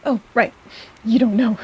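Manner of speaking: normal talking voice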